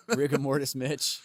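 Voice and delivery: ordinary delivery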